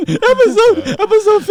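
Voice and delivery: very high, loud mock announcer; hammed-up plug. Plugging the podcast episode in a very high, loud mock voice. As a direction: sell the episode like a crazed announcer.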